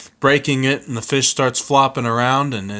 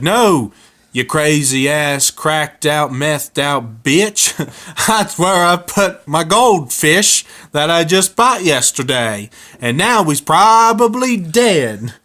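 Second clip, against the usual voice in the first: higher, louder angry rant, laughing at the start; furious yell; 5 semitones higher, 4 dB louder, slower than usual. Acted. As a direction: scream at the wife in a panic over the fish. Cletus yells at his wife.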